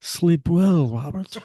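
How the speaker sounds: sloppy, slovenly slug creature voice; slow slurpy slug. Acted. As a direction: slur it out like a lazy slug. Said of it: "my new ringtone".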